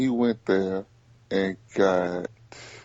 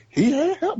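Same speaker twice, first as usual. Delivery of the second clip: high-pitched insistence; 4 dB louder than usual. Real, not acted. Insisting to a friend that LeBron had help, high-pitched. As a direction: repeat it way up high, louder. Hammering the point by repeating it.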